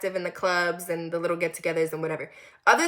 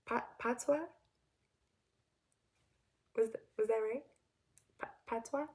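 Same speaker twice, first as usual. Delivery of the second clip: soft, hesitant questioning try; unsure attempt; 12 dB quieter than usual. Real, not acted. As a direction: test the word quietly, unsure. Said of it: "I don't know if I'm gonna say it right".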